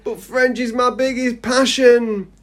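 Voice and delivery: high, drawn-out theatrical protest; melodramatic lament. Acted. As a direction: wail it like a thwarted hero. Melodramatic lament of thwarted pupil.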